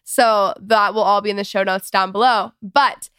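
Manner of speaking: ordinary delivery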